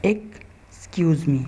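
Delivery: slow model Excuse me; correct pronunciation. Shown as a demonstration. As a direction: Excuse me slow, each syllable clear. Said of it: "किस तरह pronounce करेंगे".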